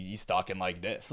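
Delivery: low, soft put-on voice; faked deep voice. Showing viewers how he fakes it, mocking deep voice. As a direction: drop it down to mimic a phony deep voice. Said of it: "basically what he does is just simply".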